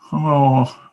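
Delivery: normal talking voice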